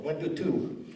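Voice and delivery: soft, unsure mic check; a hint of an elder on the mic; elder testing mic. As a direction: test the mic like an elder who doesn't trust it. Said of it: "my impersonation of elders on the microphone".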